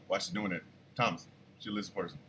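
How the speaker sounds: very soft, low imitation of a wife; hushed conspiratorial tip to her husband; wife's deadpan aside. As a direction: murmur it low, dead serious.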